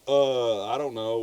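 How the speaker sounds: ordinary delivery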